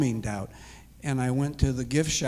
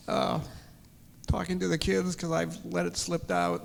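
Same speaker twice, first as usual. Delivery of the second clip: ordinary talk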